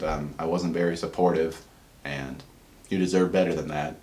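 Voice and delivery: gently, like a father finally owning up; soft sincere dad. Retold or imitated. Dad apologizes to his daughter Kelly, softly and sincerely.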